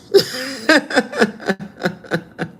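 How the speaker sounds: high burst of laughter tapering off; real laughter. Real, not acted. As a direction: crack up at your own silly grunt. Amused by own grunt impression.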